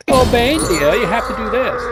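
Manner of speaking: high, swooping, loud coaxing voice; hammed-up high-pitched sweet talk to a henchman; Uma impression. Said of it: "was that your Uma impression".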